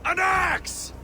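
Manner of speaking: high, frantic shout; desperate shout. Acted. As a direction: scream it in desperation. Desperate father demands an axe.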